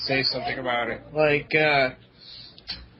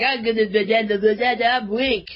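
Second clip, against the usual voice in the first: higher, stammering dumb Link voice; speech impediment imitation; 6 semitones higher than usual. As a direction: stutter on the da's like a dim hero. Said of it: "gave Link a speech impediment".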